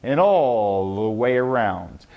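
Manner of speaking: low, slow, rolling delivery; carried-out words. Shown as a demonstration. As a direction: stretch ALL out long. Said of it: "carry out your words".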